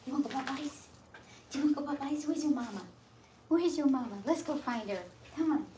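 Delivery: high excited pet voice. Real, not acted. Groomer cheerfully asking the dog if it wants to find mama.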